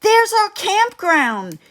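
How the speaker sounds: high, loud and thrilled; excited yell. A narrator's reading. Fred the dog yells spotting his campground, high excited shout. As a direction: shout it as you spot it. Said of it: "Fred yells".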